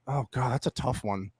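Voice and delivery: ordinary delivery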